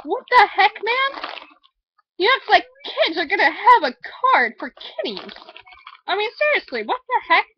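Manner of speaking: high, indignant what the heck; exasperated outburst. Retold or imitated. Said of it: "and I'm like".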